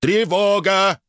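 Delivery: high, loud robotic cawing; cawed alarm. A narrator's reading. Robot raising the alarm, high loud caw. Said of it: "ещё раз каркнул сэр Найджел".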